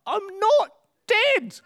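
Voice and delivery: put-on very high, slow squeaky old-man cry; high, laughing old-man voice insisting he's alive; old man protesting. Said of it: "the old man calls out".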